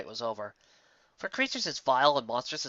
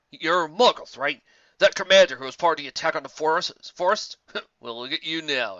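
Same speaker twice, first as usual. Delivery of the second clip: a louder, taunting soldier voice; a hint of a sneering soldier; lightly voiced mocking officer; 2 semitones higher, 5 dB louder than usual. A narrator's reading. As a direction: jeer at him like a smug officer.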